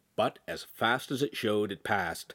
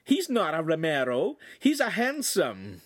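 high mock-Italian voice; hammed-up fake Italian; silly Italian accent; 8 semitones higher, 4 dB louder than usual. A narrator's reading. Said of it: "speaking in a silly Italian accent".

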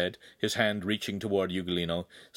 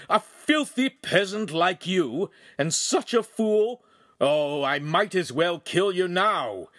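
high, loud, slow contempt; snarled; 9 semitones higher, 5 dB louder, slower than usual. A narrator's reading. Noble threatening the lowly peasant, snarled contempt. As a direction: spit out insults with drawn-out menace. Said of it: "Then he snarled".

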